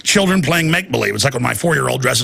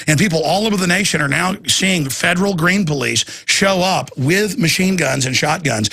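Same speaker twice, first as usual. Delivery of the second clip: ordinary talk, nothing marked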